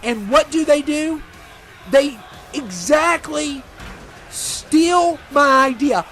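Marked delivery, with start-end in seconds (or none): 1.9-6.0 slow, drawn-out, higher, every word hammered; indignant emphasis. Real, not acted. Commentator fuming that wrestlers stole his team name, slow and pointed. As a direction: spell out "They exactly steal my idea" slowly, each word punched.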